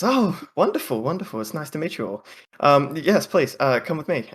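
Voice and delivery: high, bubbly welcome, pitch well up; cheery halfling woman. Acted. Halfling woman greeting the party warmly, high and bubbly. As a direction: gush over the new guests. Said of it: "comes wandering out and says".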